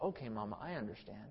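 put-on very soft, low child's reply; quiet, dutiful mock agreement; compliant little son. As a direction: imitate a child meekly giving in. Child meekly answers mother, retold.

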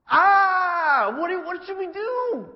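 very high, very loud wail of "what should we do"; panicked crowd howling for help; desperate crying shout. Retold or imitated. Cut-to-the-heart crowd shouting desperately at Peter. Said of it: "Actually, it was probably more like".

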